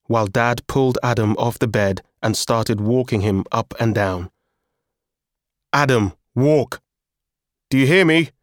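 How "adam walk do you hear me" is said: raised, higher commanding voice; ordering. A narrator's reading. Dad walking drugged Adam, urgent command. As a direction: order someone to stay on their feet. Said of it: "Dad ordered".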